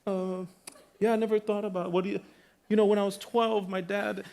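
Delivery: high, stammering sandwich guy; voicing a stunned server. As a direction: play the caught-off-guard clerk, pitched high. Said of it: "And he's like".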